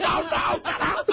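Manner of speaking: high, pleading teacher impression; mock panic at an unwanted visitor; Miss Fulcomer. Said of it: "My sister does an impression of her".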